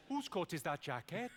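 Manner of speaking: puzzled Welsh question; mock Welsh bewilderment; confused Welsh accent. Shown as a demonstration. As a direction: sound baffled in a Welsh accent. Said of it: "you've just got to sound confused".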